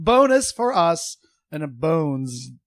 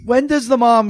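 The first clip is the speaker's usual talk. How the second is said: laughing; 5 semitones higher, 6 dB louder than usual. Real, not acted. Laughing at own question.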